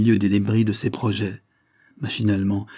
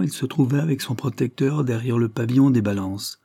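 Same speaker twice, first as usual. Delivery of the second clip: ordinary delivery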